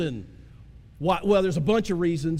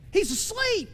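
high sing-song; 11 semitones higher than usual. Acted. Preacher stresses Jesus asleep.

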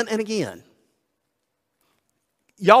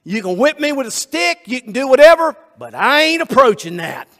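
loud, high, defiant donkey voice; the donkey flat out refusing its master, loud and stubborn; 4 semitones higher, 3 dB louder than usual. Retold or imitated. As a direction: dig in your heels and refuse as the donkey. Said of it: "and the donkey says".

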